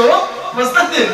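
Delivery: high, loud exclaimed disbelief; stunned guest staring at yet another dish; mock disbelief. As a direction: guest stunned that the food keeps coming. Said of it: "Ich so".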